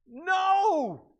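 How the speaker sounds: high, loud "No"; a plain, final no; thief's no. Retold or imitated. Thief answers third time.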